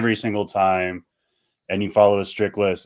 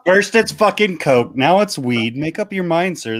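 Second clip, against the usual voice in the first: mock outrage; 9 semitones higher than usual. Real, not acted. Fake outrage at the host after the weed question. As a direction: play up being wrongly accused, pitched way up.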